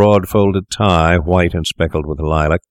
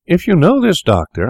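high-pitched, cautious opening to a stranger; tentative remark; 12 semitones higher than usual. A narrator's reading. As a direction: venture it carefully, pitched well up. Tentative venture after awful pause.

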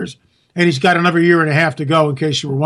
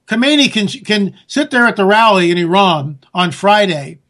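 usual speaking voice

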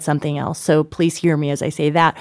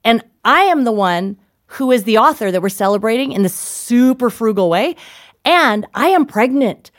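higher, emphatic mock outrage; retold indignation; 4 semitones higher than usual. Wife retelling her complaint about chocolate, playfully indignant. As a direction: spell out the grievance with hammed-up indignation. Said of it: "So I'm just mad".

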